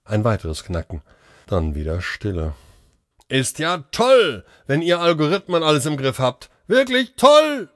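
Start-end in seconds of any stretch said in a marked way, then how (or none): 3.3-7.7 sarcastic bellow. A narrator's reading. Doctor bellowing sarcastically at the machines, sarcastic roar. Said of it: "brüllte Kunz".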